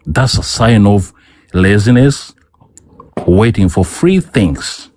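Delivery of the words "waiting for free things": normal talking voice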